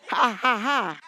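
spoken-out, emphatic ha-has; preacher's prompting laugh; deliberate laughter. Shown as a demonstration. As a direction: laugh it out on purpose, beat by beat. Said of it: "Laugh, Monica".

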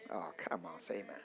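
usual speaking voice

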